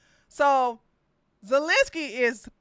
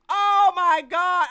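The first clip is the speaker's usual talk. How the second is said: normal talking voice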